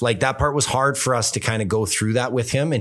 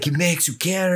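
lightly imitated French Canadian accent, pitched up; attempted French Canadian accent; 5 semitones higher, slower than usual. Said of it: "I can't do the French Canadian accent".

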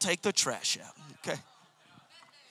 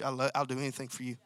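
soft, lower, quicker eager devotion; devoted tone; 8 semitones lower, 7 dB quieter, faster than usual. Shown as a demonstration. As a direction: earnestly offer everything to her. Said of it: "you encapsulate that message".

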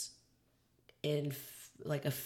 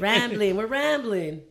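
real loud, higher outburst; 5 semitones higher, 8 dB louder, slower than usual. Real, not acted. Echoing the co-host about going off topic, too loud. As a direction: burst in too loudly, pitch raised. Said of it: "That was loud".

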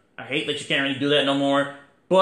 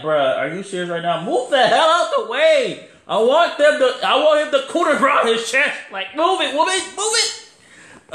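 much higher, louder, laughing irritation; high mock-angry rant; pissed-off Finn Balor; 9 semitones higher, 3 dB louder than usual. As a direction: shove him aside with annoyed, amused shouting. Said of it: "Finn Balor was so pissed".

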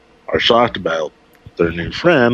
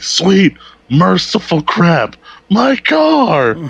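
Homer's yell; 11 semitones higher, much slower than usual. Retold or imitated. Homer discovers his wrecked car, yelling in dismay.

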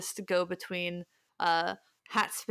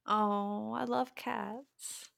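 fond coo; 3 semitones higher, 4 dB quieter, much slower than usual. Real, not acted. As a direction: croon it like you adore cats. Cat lover gushes.

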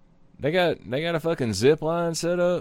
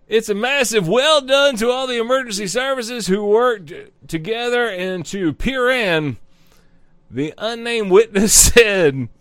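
news read; 5 semitones higher than usual. Acted. Reading news article aloud.

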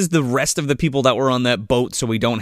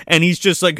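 ordinary talk

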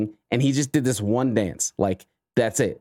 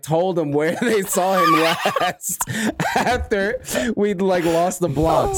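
laughing; 6 semitones higher, slower than usual. Real, not acted. Laughing through funny story.

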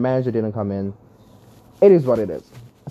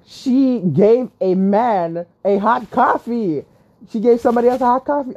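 higher, incredulous, words drawn out then rushed; scandalized retelling, pitched up; outraged disbelief; 9 semitones higher than usual. Retold or imitated. Customer who saw someone else get hot coffee, outraged.

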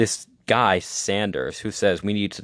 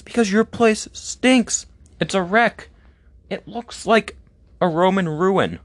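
much higher, squeaky girlish mock voice; mock date; 11 semitones higher than usual. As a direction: play the date turning him down in a high voice. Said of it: "And she'll be like".

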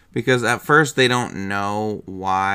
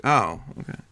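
usual speaking voice

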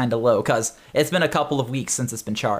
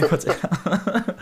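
ordinary talk

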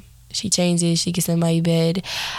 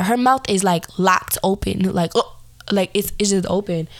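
ordinary delivery

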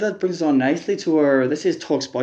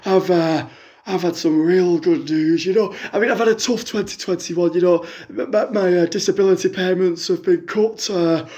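a lightly put-on middle-aged fan on the phone; a fan phoning in with a sob story, lightly voiced; Jackie caller voice; 3 semitones higher than usual. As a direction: play the hard-up caller, chatty and woeful.

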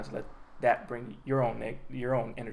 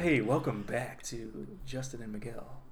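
put-on voice; 3 semitones lower, 6 dB quieter than usual. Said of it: "I'm only gonna talk like this now".

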